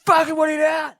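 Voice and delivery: high shouted challenge; retold shout. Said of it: "Like that".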